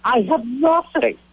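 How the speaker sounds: high-pitched helpless protest; acted-out panicked admission; retold panic. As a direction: pitch it way up, mock desperate. Panicking at having no ID.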